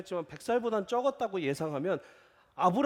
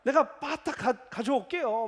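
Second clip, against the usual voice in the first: high, loud, eager promise; eager younger brother; 5 semitones higher, 5 dB louder than usual. Retold or imitated. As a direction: rush to please the elder. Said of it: "막 이러겠죠?". (형님께 높고 크게 서두르며 약속하는 말투)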